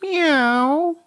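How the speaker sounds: loud, very high cat meow; complaining cat. A narrator's reading. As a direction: yowl like an impatient cat. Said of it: "loudly complained".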